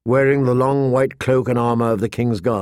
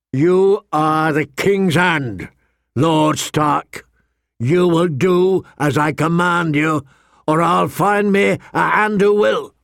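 higher, slow angry royal command; 5 semitones higher, slower than usual. Acted. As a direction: order it, pointing in anger. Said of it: "He pointed an angry finger".

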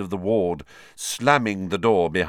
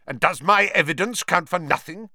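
sharp, higher snarl; 9 semitones higher, 4 dB louder than usual. Acted. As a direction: snarl it with contempt. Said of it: "snarled Snape".

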